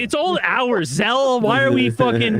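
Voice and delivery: griping at friends about the hours; worked-up rant. Real, not acted. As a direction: vent about work shifts, rising. Complaining about work hours.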